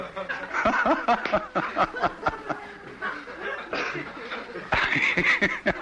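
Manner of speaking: drawn-out delighted laugh; long high laugh. Real, not acted. Long laugh after story.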